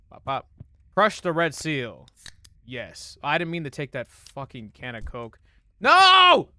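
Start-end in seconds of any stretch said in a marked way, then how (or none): 5.8-6.5 high, loud drawn-out cry; dismayed yell. Real, not acted. Player regretting a wasted health pickup, dismayed. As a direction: shout it in dismay. Mistake in the game.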